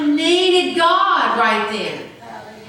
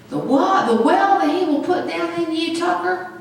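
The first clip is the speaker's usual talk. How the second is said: ordinary delivery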